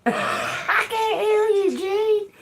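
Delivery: high, slow mock call through laughter; hammed-up high quote, laughing; laughing imitation. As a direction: imitate him while cracking up. Imitates Joe, bursts out laughing.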